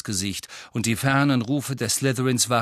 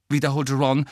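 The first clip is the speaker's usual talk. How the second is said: ordinary delivery, nothing marked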